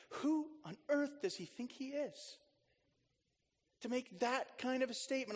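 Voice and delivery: preacher voicing the scribes' scandalised thoughts; indignant scribes.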